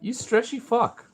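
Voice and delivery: high squeaky voice for the dog; pet talk. Acted. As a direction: coo at the dog like a baby. Teasing the dogs Vito, Jazzy.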